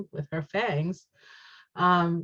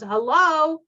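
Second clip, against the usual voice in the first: delighted greeting; 9 semitones higher, 7 dB louder than usual. Real, not acted. Delighted at card pairing.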